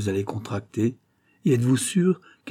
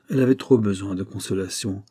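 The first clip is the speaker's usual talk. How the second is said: plain reading voice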